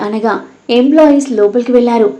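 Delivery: plain reading voice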